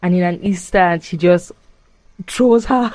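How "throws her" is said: shocked excitement. Retold or imitated. Retelling shocking twist.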